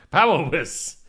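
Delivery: put-on German accent, slow and laughing; drawn-out German-accented word; mock German accent. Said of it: "trying to do a German accent".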